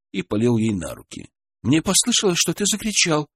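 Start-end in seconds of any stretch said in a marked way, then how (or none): 1.7-3.3 high, tearful stress on «послышалось»; through tears. A narrator's reading. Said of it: "сквозь слёзы произнесла Ольга".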